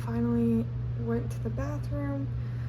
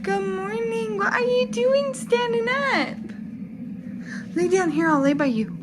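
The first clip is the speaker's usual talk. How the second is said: bright morning voice for a little one; 10 semitones higher, 9 dB louder than usual. Real, not acted. Waking toddler in crib.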